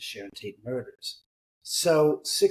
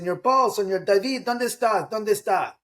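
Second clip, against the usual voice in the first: higher-pitched searching call in Spanish; imitated calling out; 7 semitones higher, 3 dB louder than usual. Laborer upstairs looking for his bosses, imitated calling out. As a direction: call out for someone, pitched well up. Retold worker calling out for men.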